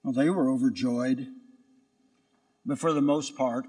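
ordinary delivery

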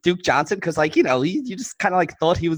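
put-on ditzy Valley Girl hedging with likes. Host demonstrates Valley Girl accent for co-host who asked. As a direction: play a Valley Girl, every phrase loosely tentative. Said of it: "entire show in Valley Girl".

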